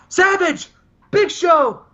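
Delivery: hammed-up wrestler promo to rivals; mock Sting promo. Sting calls out Savage and Big Show, hammed-up promo shouting. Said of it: "and Sting goes".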